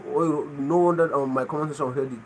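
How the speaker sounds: ordinary talk, nothing marked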